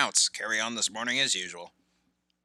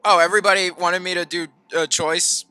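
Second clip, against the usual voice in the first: loud, high, sarcastic comeback; heated, raised-voice retort; angry retort; 8 semitones higher, 13 dB louder than usual. Acted. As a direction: throw it back loudly. Acted quarrel continues.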